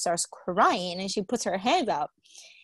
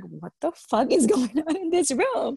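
higher-pitched, laughing disbelief; amused disbelief; 5 semitones higher than usual. Retold or imitated. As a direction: retell your shock with a laugh. Retelling own shock at the reading.

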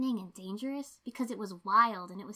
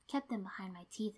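ordinary delivery, nothing marked

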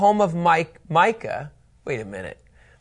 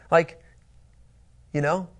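normal talking voice

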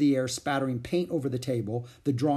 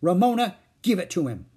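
louder, higher big-sister order; 6 semitones higher, 6 dB louder than usual. A narrator's reading. Beezus ordering Ramona to hand it back. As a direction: command the little one. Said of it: "ordered Beezus".

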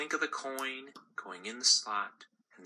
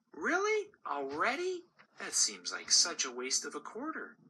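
surprised mother; 7 semitones higher than usual. Acted. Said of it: "mother answering daughter".

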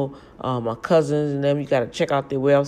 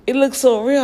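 amazed; 10 semitones higher, 8 dB louder than usual. Retold or imitated. Amazed at the poster.

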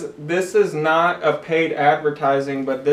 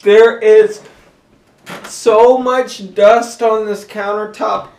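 loud, higher, slowed disgust; mock outrage; 5 semitones higher, 10 dB louder, slower than usual. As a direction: scold the dusty countertop. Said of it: "I like walking in and being like".

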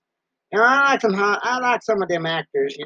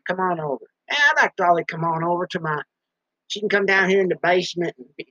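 ordinary talk

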